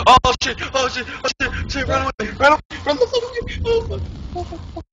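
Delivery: real panic shrieks while running from the monster; panicked screaming. Real, not acted. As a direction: scream as if it's right behind you. Fleeing Slender in panic.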